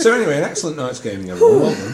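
laughing sign-off. Pretend. Mock wrap-up of the session.

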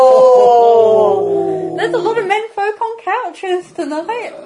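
loud exclaiming. Acted. Shouted joke in play.

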